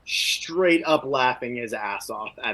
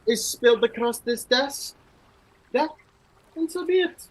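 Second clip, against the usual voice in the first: higher, louder proud scorn; scornful warrior; 8 semitones higher, 5 dB louder than usual. Acted. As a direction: sneer at the primping peacock. Brynjar scorns the peacock.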